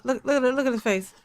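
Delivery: ordinary delivery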